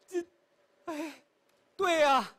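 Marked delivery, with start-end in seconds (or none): none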